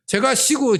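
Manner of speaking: usual speaking voice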